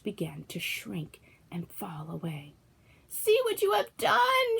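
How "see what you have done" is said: high, slow shrieking witch; the witch screaming as she melts. A narrator's reading. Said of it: "she screamed".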